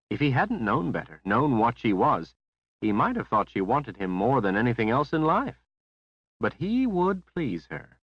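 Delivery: plain reading voice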